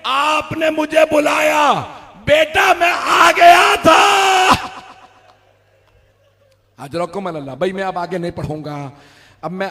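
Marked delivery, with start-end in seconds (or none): 0.1-4.5 high, slow, loud sobbing cry; weeping call. Acted. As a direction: cry out to the fallen boy in grief. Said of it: "मौला ने रोके कहा".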